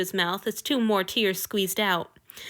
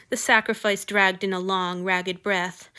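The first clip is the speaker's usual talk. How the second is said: ordinary delivery, nothing marked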